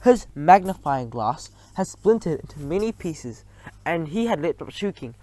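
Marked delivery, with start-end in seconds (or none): none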